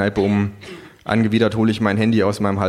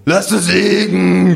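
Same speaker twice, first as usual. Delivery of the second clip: loud, high, slowed yell; bawling drunk; 10 semitones higher, 7 dB louder, slower than usual. A narrator's reading. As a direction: bawl it out across the bar. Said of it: "gröle ich".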